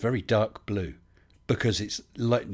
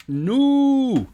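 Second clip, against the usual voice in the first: mock character voice; 9 dB louder than usual. Streamer voicing his character Max.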